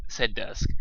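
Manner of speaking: ordinary delivery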